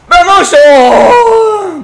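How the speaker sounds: loud excited shout. Real, not acted. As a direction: yell it at the screen. Shouting at near goal.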